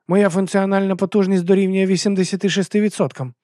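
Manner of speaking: high-pitched robot helper reporting; earnest little bot. A narrator's reading. Bot Miki reports damage.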